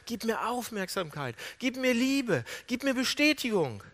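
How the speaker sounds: high, yearning appeal; pleading. Retold or imitated. Jacob begging his father for attention and love, pleading.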